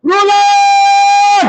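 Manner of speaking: high, loud single-word shout. Shown as a demonstration. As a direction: bellow it so the back row hears. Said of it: "Pakilakasan mo yun".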